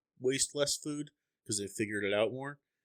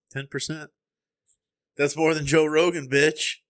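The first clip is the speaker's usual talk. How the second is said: loud, higher-pitched mock brag; mock boasting; 6 semitones higher, 8 dB louder than usual. Host crows over beating Joe Rogan percentage-wise, loud mock boast. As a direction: crow it loudly like a tiny-time champion.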